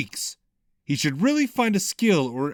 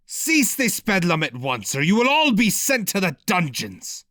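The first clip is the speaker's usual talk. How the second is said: bellowing; 6 semitones higher, 3 dB louder than usual. A narrator's reading. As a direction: roar the order at the crowd. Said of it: "Amorlik bellowed".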